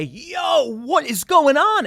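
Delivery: high, loud mock outcry; mock alarmed states. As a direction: squeal it like a panicked bystander. States voiced in a retold history.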